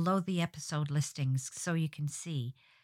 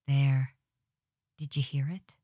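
ordinary talk

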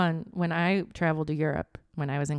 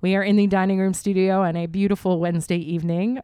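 podcast host intro; 4 dB louder than usual. Acted.

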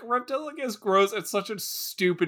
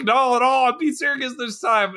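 higher, louder mock plea of a desperate man; half-hearted mock desperation, pitched up; pleading; 6 semitones higher, 4 dB louder than usual. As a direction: play the boy who cried wolf begging to be believed. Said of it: "And he's like".

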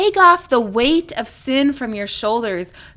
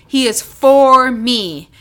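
for slowed and louder; stress on FOR; 4 dB louder, slower than usual. Shown as a demonstration. Preacher repeating, stress on for. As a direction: dwell on the word for. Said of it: "The next one is the word for".

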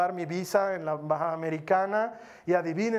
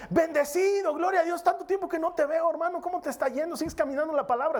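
much higher and faster, gushing church lingo; churchy brother; 9 semitones higher than usual. Retold or imitated. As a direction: play an overeager church brother. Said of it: "me habla en jerga de cristiano". (un hermano lo saluda en jerga cristiana, agudo y rápido)

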